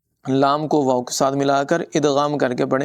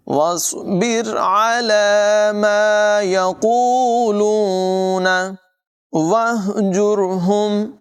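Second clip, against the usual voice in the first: slow, higher melodic tajweed chant; Quran recitation; 6 semitones higher, much slower than usual. Shown as a demonstration. Teacher recites the verse for students, slow melodic chant. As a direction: chant each word long and flowing.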